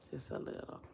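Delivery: breathy whisper of Just a little, barely audible; whispered aside. Real, not acted. Teasing the viewers, she whispers the words. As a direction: drop to a secret whisper.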